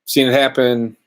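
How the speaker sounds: ordinary talk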